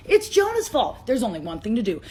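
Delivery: ordinary talk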